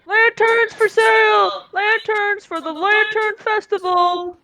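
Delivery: high, slow street vendor's cry; yelling. Acted. Vendors selling lanterns to the festival crowd, yelling. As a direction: hawk your wares, stretching the words out. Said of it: "you hear people yelling".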